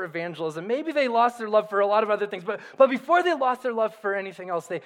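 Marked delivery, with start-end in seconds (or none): none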